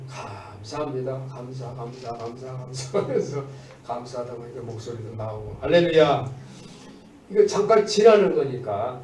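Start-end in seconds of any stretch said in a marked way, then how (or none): none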